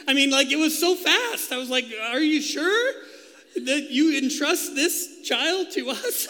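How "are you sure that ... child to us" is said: laughing disbelief. Retold or imitated. Retelling his disbelief as new dad.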